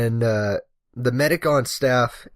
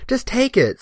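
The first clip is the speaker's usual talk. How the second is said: high, impatient mock demand; bossy order; 13 semitones higher than usual. Older woman brushing off the firefighter's questions, bossy order. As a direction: imitate an old woman snapping an order. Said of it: "just kinda ordering me".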